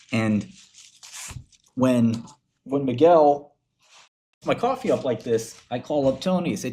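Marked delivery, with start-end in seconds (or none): none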